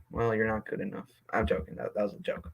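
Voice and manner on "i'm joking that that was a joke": whispered aside. Real, not acted. Quick backpedal after a jab.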